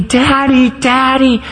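put-on high child's cry for daddy; retold sobbing cry. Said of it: "sobbing and screaming".

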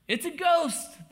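high, loud, drawn-out cry of alarm; fearful outcry. Retold or imitated. Disciples spot a figure on the lake, crying out in fear. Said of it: "they cried out in fear".

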